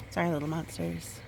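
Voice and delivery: usual speaking voice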